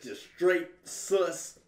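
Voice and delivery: slow, drawn-out teasing; slow drawl. Real, not acted. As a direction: stretch every word.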